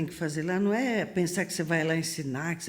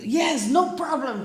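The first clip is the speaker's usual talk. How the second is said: slow, higher pretend call; pretending to talk on a dead radio; fake radio talk; 7 semitones higher, 4 dB louder, slower than usual.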